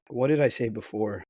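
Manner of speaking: ordinary talk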